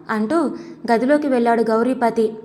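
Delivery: usual speaking voice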